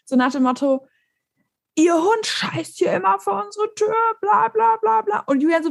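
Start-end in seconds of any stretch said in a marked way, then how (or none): 1.8-5.2 high-pitched, drawn-out imitation of an angry rant; mock angry yell. Angry dog-owner complaint retold to a friend, mock yelling. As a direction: mimic the man yelling, pitched way up. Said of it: "schrie Julian an".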